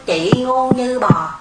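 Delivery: nói chậm từng chữ, như chỉ là âm thanh; buông câu chửi thật chậm, không cảm xúc; chậm rãi, thản nhiên. Shown as a demonstration. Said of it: "chỉ là âm thanh thôi". (đọc chậm, coi như âm thanh suông)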